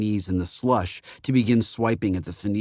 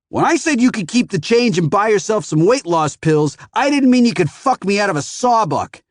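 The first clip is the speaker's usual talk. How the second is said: much higher, louder sneering rant; contemptuous rant; 10 semitones higher, 6 dB louder than usual. A narrator's reading. Phoenician berates the kid over the ten, contemptuous. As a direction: tear into the kid. Furious customer berates teen.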